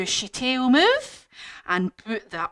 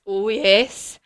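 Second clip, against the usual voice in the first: usual speaking voice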